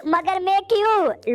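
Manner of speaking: high, drawn-out objection; whiny protest. Acted. Mota balking at the order to check the body, high drawn-out protest. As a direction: stretch it upward in reluctant disbelief.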